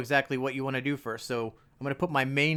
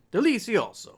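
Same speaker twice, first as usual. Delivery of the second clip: hammed-up Italian chef, higher and louder; a quick mock Italian flourish; mock Italian exclamation; Italian man; 8 semitones higher, 4 dB louder than usual. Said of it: "I said that like an Italian man".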